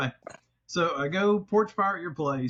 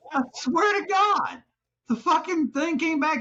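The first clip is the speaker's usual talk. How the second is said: worked-up disbelief; 11 semitones higher than usual. Real, not acted. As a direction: stretch it out in amazement. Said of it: "still worked up".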